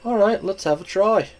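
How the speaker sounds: put-on brash Irish-tinged girl voice; a cocky young scrapper, lightly voiced; Kid's voice. Kid voiced in story.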